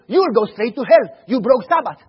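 loud stern rebuke; stern scolding. Retold or imitated. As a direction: scold the child for breaking Sabbath. Church elders scolding child.

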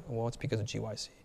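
soft, quick, timid answer; sheepish reply. Retold or imitated. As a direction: mumble it like a nervous son.

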